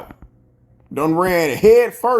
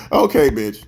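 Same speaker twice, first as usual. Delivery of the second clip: dismissive; 7 semitones lower than usual. Real, not acted. Dismissive after laughing.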